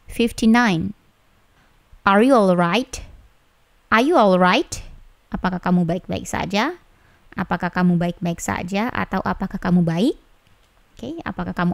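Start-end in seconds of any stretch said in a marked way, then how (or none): none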